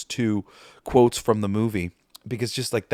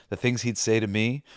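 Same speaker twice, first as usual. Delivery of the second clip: ordinary talk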